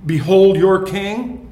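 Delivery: loud, slow, proclaimed with a rise on "Behold"; mocking proclamation. Preacher proclaims Pilate's mocking verdict to the crowd, loud and slow. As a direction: announce "Behold your king" slowly and loudly, as Pilate's mockery. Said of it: "three mocking verdicts by Pilate".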